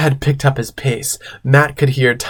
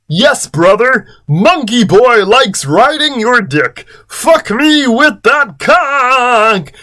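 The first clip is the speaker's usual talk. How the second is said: hammed-up high, loud cry; slow, swooping mock-passionate voice; Chris; 10 semitones higher, slower than usual. A narrator's reading. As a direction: call it out high and drawn-out. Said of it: "Chris said loudly".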